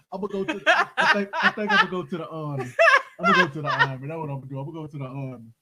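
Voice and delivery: loud, laughing declaration. Real, not acted. Telling hosts he fled to the army, laughing. Laughing about quitting street life.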